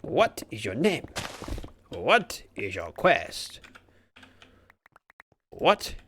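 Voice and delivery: slow, drawn-out questioning with swooping pitch; lightly voiced solemn questioner, stretching each question; hammed-up quizmaster. Streamer quoting the Monty Python questions, lightly hammed-up interrogation.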